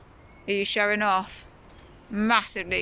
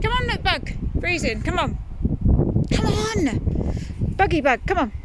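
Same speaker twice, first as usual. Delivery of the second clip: higher, louder repeated calls; coaxing call; 8 semitones higher, 3 dB louder than usual. Real, not acted. Mum coaxes her child inside out of the cold, raised insistent calls.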